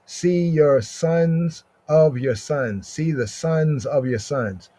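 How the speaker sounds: normal talking voice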